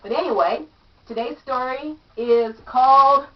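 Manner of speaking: ordinary delivery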